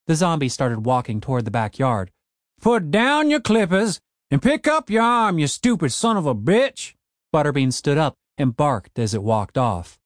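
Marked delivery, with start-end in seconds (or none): 2.6-6.9 put-on cranky old man. A narrator's reading. Old man ordering his broken zombie gardener, gruff old voice.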